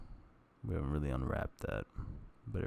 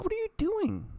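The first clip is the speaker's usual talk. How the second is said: much higher shocked scold; squeaky scolding; 5 dB louder than usual. Retold or imitated. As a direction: squeak it at yourself in alarm. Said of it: "I'm like".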